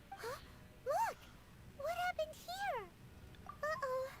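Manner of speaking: alarmed call. Acted. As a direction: point it out in alarm. Sudden alarm.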